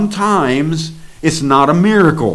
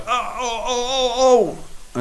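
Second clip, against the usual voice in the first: high amazed oh gasps; 12 semitones higher, 5 dB quieter than usual. Retold or imitated. Man gasping as arm lifted.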